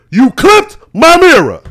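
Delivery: high, loud, drawn-out accusation. Retold or imitated. Confronting a driver who hit the mirror, loud accusation. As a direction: call out the accusation slowly and forcefully.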